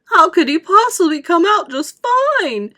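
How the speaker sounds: high, loud, incredulous; frantic protest. A narrator's reading. Mother rejects Wonka's reassurance, frantic. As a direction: protest at the top of your voice.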